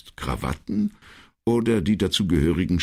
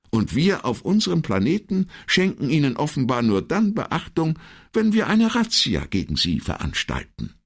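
lightly voiced secretary, higher in pitch; earnest secretary; 11 semitones higher than usual. Secretary concludes her case to the commissario, earnest.

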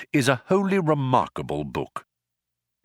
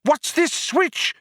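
very high-pitched urgent question; excited cry; slower than usual. A narrator's reading. Companion lunges at a possible escape, excited cry. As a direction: cry out as if salvation is in sight. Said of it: "he cried".

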